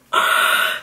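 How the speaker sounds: sharp breathy gasp; mock gasp. Reacting to the paparazzi with a mock gasp. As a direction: gasp in fake shock. Dramatic gasp after quote.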